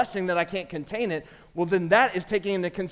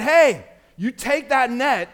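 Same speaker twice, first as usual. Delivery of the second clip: louder and slow, pressing the point; urgent; 4 semitones higher, 7 dB louder, slower than usual. Shown as a demonstration. Peter urging the church to fling it away, loud and earnest. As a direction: drive it home with force. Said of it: "I think he said".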